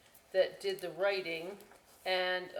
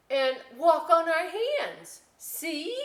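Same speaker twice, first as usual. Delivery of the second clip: much higher, louder, slow; eager girl; 11 semitones higher, 10 dB louder, slower than usual. Acted. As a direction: show it off.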